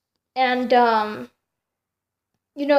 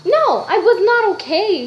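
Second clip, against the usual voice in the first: emphatic; 6 semitones higher, 6 dB louder than usual. Real, not acted. Correcting the sarcasm emphatically.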